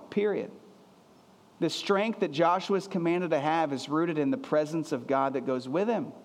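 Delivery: ordinary talk, nothing marked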